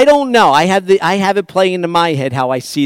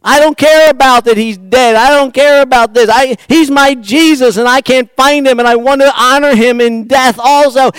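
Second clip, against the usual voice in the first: high, loud pleading cry; anguished loud demand; desperate shout; 9 semitones higher, 10 dB louder than usual. Acted. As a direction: shout it like a grieving woman begging. Preacher voicing Mary's desperate plea.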